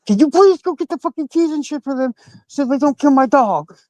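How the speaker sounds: high, frantic plea; freaking out. Retold or imitated. Cuffed on the ground, begging his family for the keys, frantic high plea. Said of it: "So I start freaking out".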